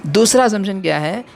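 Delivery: ordinary talk, nothing marked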